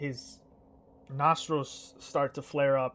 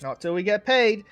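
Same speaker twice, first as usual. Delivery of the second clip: "Not till we get paid" higher and louder, landing on "paid"; louder repeat; 6 semitones higher, 8 dB louder than usual. Acted. Twin hammering home his terms to the king, louder. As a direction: repeat it with more force on "paid". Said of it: "He's like".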